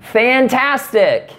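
put-on sarcastic girlfriend's brush-off; sarcastic fantastic. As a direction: drag out "Fantastic" high and slow, clearly fake. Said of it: "You see, you can't do it".

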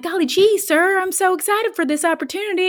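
put-on high, gushing Southern accent. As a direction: play an eager girl with a high Southern twang. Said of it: "I gave her a Southern accent".

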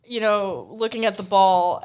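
ordinary talk

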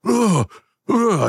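very high, slow groaning daze; dazed dwarf. Acted. Beaten dwarf reels out the door, high and groaning. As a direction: reel from the beating. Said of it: "he's like".

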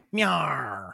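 low slow gruff meow; a pirate man meowing. Shown as a demonstration. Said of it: "if it was a man meowing, a pirate meowing".

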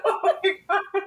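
high-pitched, real helpless laughter; laughing. Real, not acted. Reacting to co-host's Rasputin joke, laughing hard. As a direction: gasp it out through high laughter.

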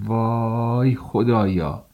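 slow, despairing sigh to God; a hopeless, drawn-out plea. Acted. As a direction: drag it out in helpless despair. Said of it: "دست رو با استیصال بلند کردم".

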